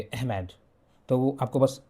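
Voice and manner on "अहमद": lightly imitated British accent, अहमद drawn to "ehh-med". Shown as a demonstration. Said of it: "अहमद को बोलेंगे तो वो ऐसे ही बोलेंगे". (हल्का ब्रिटिश लहजा)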